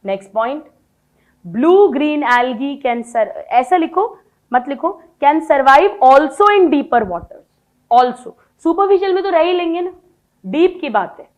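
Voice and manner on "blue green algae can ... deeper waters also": word by word dictation, very slow and louder. Shown as a demonstration. Teacher dictating biology notes to her class, very slow. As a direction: read it out slowly so they can write.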